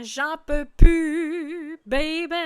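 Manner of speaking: a long desperate wail of fed up; drawn-out despair. Shown as a demonstration. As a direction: stretch it out like a song's cry. Lyric, stretched out.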